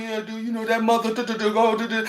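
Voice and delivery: low, quick, offhand mumble of a son; imitating son's phone talk. Mother mimicking her son on the phone, lightly voiced. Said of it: "That's how he talk".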